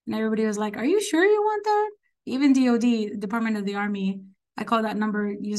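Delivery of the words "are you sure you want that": high-pitched doubtful question; incredulous doubt. Retold or imitated. Everybody doubts her choice of post.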